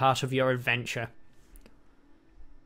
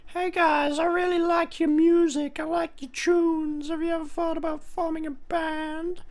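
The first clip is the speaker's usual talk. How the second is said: much higher, naive cartoon bear; dopey bear voice; 14 semitones higher than usual. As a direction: play a clueless friendly teddy. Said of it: "and he's just like".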